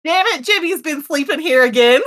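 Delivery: hammed-up country twang; country accent. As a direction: grumble like a put-out country farmer. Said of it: "put on your best country accent".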